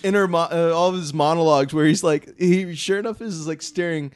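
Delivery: giggling through the words; laughing. Real, not acted. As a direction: laugh while you tell it. Recalling a funny trailer, laughing.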